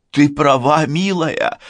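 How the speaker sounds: high, emotional concession; tearful. A narrator's reading. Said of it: "тоже плача".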